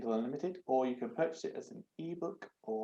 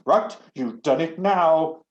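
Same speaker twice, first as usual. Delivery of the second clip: loud, slow angry snap; snapping; 5 semitones higher, 14 dB louder, slower than usual. Acted. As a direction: bite out the words furiously. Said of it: "the knob snapped".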